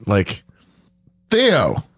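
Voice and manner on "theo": high calling voice. Retold or imitated. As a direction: call out a name, rising high. Calling out to Theo.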